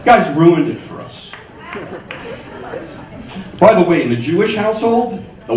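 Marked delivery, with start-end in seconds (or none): none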